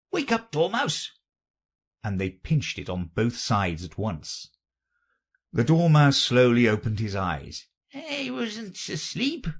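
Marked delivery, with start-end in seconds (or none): 0.1-1.1 high-pitched wake-up call; crying out. A narrator's reading. Both call to the sleeping Dormouse, crying out. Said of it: "they both cried". 7.9-9.5 slow, high, feeble sleepy voice; hoarse, feeble. A narrator's reading. The Dormouse denies sleeping, hoarse and feeble. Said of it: "in a hoarse, feeble voice".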